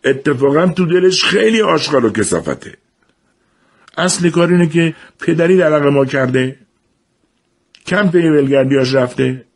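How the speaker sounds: red-faced shout. A narrator's reading. Daghar lashes out at his mother over father, shouting. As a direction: spit it out with mounting rage at the father. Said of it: "یک بار داغر سرخ به رو داد زد".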